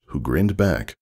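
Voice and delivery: ordinary delivery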